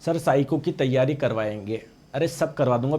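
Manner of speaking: ordinary delivery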